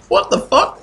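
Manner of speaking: high squeak of disbelief; can't believe this guy; incredulous squeak. Acted. Idu staring at the clerk, a high squeak of disbelief. Idu's look voiced.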